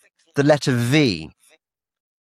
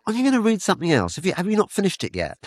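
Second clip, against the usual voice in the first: quick, higher mock wife asking; wife's voice; 7 semitones higher, faster than usual. Husband imitates his wife nagging about rereading, in a higher quick voice. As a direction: play the puzzled wife, quick and high. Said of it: "my wife saying to me".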